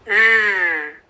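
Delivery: र as a bare sound, no vowel; just voice र without the full letter; phonics r sound. Shown as a demonstration. Teacher demonstrating the r sound without a vowel. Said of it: "र पूरा नहीं बोलना है, सिर्फ sound निकालनी है".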